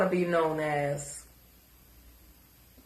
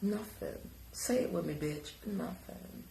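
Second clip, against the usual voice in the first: very soft, spaced-out words; hushed mock lesson; 2 semitones lower, 15 dB quieter than usual. Shown as a demonstration. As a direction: coach the word softly, taunting. Mock pronunciation drill for the other speaker.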